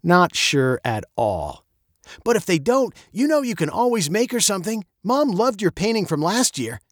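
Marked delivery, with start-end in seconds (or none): 2.2-6.8 higher-pitched, encouraging dad; coaxing father. A narrator's reading. Father suggests making Mom something, coaxing and high. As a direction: gently talk her into a fallback plan. Father offers daughter another option.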